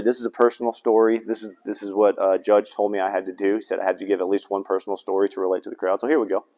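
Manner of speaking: fast, soft throwaway aside; hurried, hushed aside; quiet aside. Real, not acted. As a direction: mutter it quickly to the crowd. Aside about judge's instructions.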